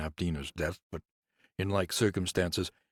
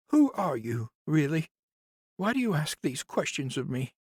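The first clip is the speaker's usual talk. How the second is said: much higher woman's voice, suspicious; higher suspicious nurse; 10 semitones higher, 3 dB louder than usual. Acted. Nurse suspiciously questions Conrad, higher voice. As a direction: challenge him warily.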